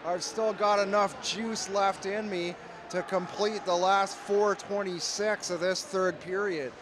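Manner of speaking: ordinary talk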